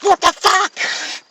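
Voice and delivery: very high, slow, drawn-out mock scream; hammed-up shriek. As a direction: stretch the fright out high. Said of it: "She's like".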